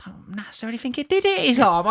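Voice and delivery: secretive hushed whisper. Pretend. Host admits doubt to viewers in a secret whisper. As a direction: whisper it like a confession. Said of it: "I can't say".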